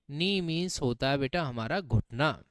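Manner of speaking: ordinary talk, nothing marked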